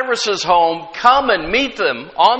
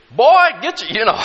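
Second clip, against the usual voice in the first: higher, mock scolding mama; mother's regular voice; 5 semitones higher, 4 dB louder than usual. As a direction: drop the phone manners and scold the boy. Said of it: "Mama had a regular voice".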